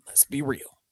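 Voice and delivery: a hushed, breathy confiding whisper; whispered aside. Real, not acted. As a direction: lean in and whisper it like a secret. Repeats the line in a whisper.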